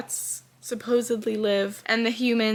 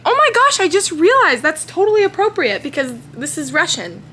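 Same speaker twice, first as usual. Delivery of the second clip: high, loud burst of delight; excited realization; 9 semitones higher, 9 dB louder than usual. Real, not acted. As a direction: exclaim as a sudden realization hits you. Sudden realization about the Russian book.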